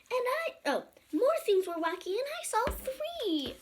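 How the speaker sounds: high amazed storybook voice. Acted. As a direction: squeak it out excitedly. Rereading the boy's line.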